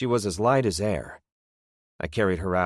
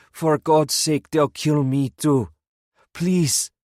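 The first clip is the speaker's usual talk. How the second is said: high, loud, slow pleading shout; desperate shout; 9 semitones higher, 6 dB louder, slower than usual. A narrator's reading. Old man fears being killed, loud desperate shouting. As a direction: scream for mercy. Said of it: "the old man shouted".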